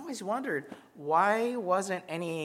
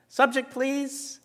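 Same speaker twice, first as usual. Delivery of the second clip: high, slow mock plea for the subject; drawn out and much higher, asking the teacher; slow pleading question; 11 semitones higher, slower than usual. Student pleading with the lecturer, slow and high. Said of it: "I'd go".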